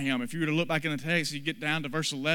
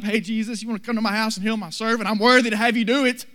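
higher, louder, fast and breezy, no humility at all; flippant and cocky; 7 semitones higher, 9 dB louder, faster than usual. Pretend. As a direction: skip up to him like he owes you, la-di-da. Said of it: "that we can't just go flippantly, la-di-da, skipping up".

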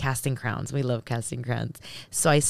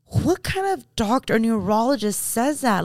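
high, louder outraged questioning; indignant disbelief; 9 semitones higher, 3 dB louder than usual. Real, not acted. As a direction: demand it, scandalized by the doctor. Indignant about neurologist's insurance question.

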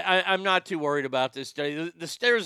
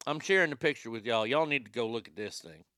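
ordinary talk, nothing marked

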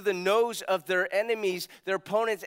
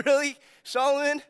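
mock incredulous; 6 semitones higher, 5 dB louder than usual.

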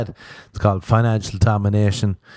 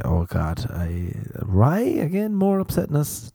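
mock weary sigh; 6 semitones higher, slower than usual. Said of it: "And I'll say".

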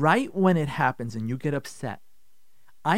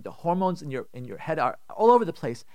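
usual speaking voice

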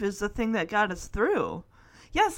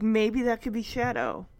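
ordinary talk, nothing marked

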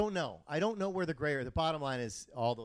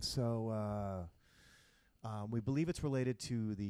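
pilot voice; 2 semitones lower, much slower than usual. Retold or imitated. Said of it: "And he's like".

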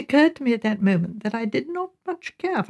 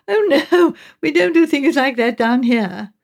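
higher, louder, lightly amused dismissal; amused surprise; 5 semitones higher, 4 dB louder than usual. A narrator's reading. Mother at bridge table amused by talk of a dance, higher and brighter. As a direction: brush it off with a little amused laugh in the voice. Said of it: "seemed surprised and amused".

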